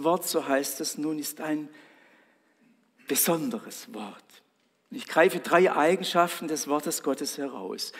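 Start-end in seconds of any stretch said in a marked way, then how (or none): none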